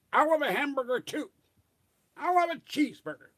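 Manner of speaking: high, loud clipped-spy imitation; Maxwell Smart impression. Crew ordering lunch, imitating Maxwell Smart high and loud. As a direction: do the cast copying Maxwell Smart. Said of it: "all talking like Maxwell Smart".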